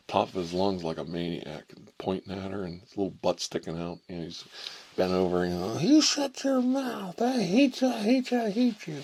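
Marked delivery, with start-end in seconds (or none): 5.8-9.0 very high, loud tantrum shriek; a kid losing it at the teacher; imitating a furious fourth-grader; screaming child. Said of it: "started screaming".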